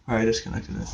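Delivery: finger over the mic, muffled; muffled and distant, mic covered. Real, not acted. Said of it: "Sorry, that was my finger".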